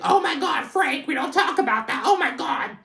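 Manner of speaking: very high, loud mock shriek; mortified Dolores. Embarrassed mom cuts off dad's sex talk, mortified mock shriek. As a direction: squeal it out as an imitation of someone horrified. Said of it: "Dolores is mortified".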